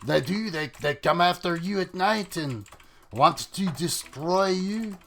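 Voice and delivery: soft mock French accent. Streamer explains the night monsters, mock French accent. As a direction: keep up a playful, put-on French accent. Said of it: "the monsters have a French accent".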